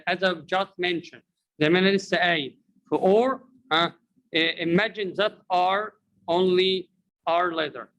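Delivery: ordinary talk, nothing marked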